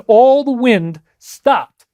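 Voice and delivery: usual speaking voice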